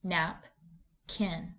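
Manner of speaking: "Nap, kin" as two separate syllables with a pause between. Shown as a demonstration. Teacher models reading each syllable, "Nap", pause, "kin", separately. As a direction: read "Nap" alone, pause, then "kin". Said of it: "read the first syllable and read the second syllable".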